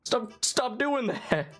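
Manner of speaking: high, drawn-out playful complaint; mock scolding. Player jokingly telling off the killer chasing them, mock scolding. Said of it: "be like".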